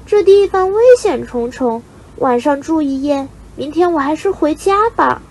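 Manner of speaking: put-on high young chick's voice, uneasy; worried little bird. A narrator's reading. Lone chick in a dangerous nest, worried. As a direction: think aloud high-pitched, anxious for home. Frightened chick alone thinks of home.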